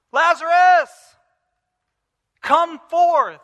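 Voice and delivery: loud, high, slow call into the tomb; commanding call. Pretend. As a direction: call the dead man out with authority. Voicing Jesus calling out.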